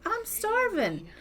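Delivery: high, slow, put-on whine; whiny starving wife. Wife in labor telling husband she is starving, high slow whine. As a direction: stretch it out like a hungry pleading wife. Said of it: "And she's like".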